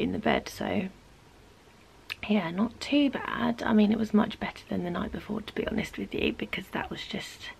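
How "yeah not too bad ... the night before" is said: usual speaking voice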